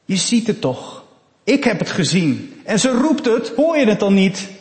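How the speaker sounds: loud, high, agitated protest; desperate father. Acted. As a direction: argue in panic for your trapped child. Desperate father argues with would-be rescuer. (luid, wanhopig protest)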